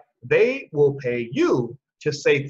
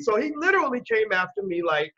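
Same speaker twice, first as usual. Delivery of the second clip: ordinary talk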